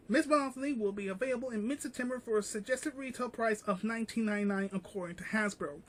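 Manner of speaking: quiet, quick reading of a news article. Acted. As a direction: read the article out quickly and softly. Reads article quietly and fast.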